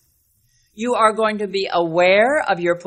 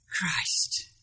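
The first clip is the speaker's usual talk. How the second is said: hushed unvoiced whisper; 11 dB quieter than usual. Shown as a demonstration. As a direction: breathe the name out.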